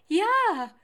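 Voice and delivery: high, loud cry of certainty; excited yell. Acted. Theo assuring Lars it is his home, excited yell. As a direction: burst out, utterly sure. Tiger excitedly confirms, shouting.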